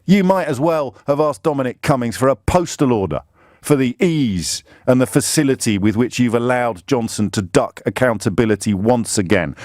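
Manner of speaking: ordinary talk, nothing marked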